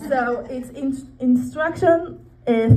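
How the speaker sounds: ordinary talk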